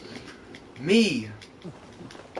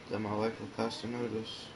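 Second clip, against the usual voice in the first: softer, lower, to himself; quiet sincere repeat; 7 semitones lower, 7 dB quieter than usual. Real, not acted. Gamer repeats the quote, quietly moved. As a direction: let the words sink in quietly.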